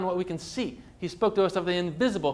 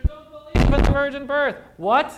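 mock disbelief; 9 semitones higher, 4 dB louder than usual. Preacher feigning shock at pastors' unbelief, indignant exclaim. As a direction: pitch jumps up in a shocked, louder exclamation.

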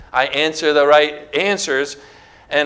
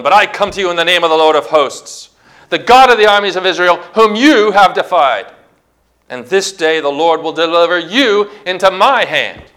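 rapid, rising, louder defiance; spoken with strength; 3 semitones higher, 4 dB louder than usual. Acted. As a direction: proclaim it powerfully at the enemy. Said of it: "He spoke him out with strength".

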